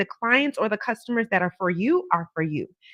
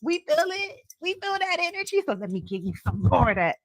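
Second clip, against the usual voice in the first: playful, higher cosmic voice answering you; the universe talking; 8 semitones higher than usual. Pretend. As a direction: speak as a generous universe, lifted high. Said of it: "The universe be like".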